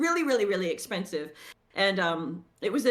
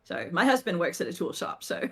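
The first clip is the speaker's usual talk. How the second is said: usual speaking voice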